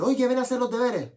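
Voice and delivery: high, loud mock call of a mother. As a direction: yell the kid away from the Play like mum. Madre llama al hijo jugando. (madre llamando en voz alta y aguda)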